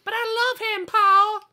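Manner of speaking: very high, hammed-up pleading; pleading girl. Daughter begs her father to spare Don, high mock plea. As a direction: beg like a lovesick daughter.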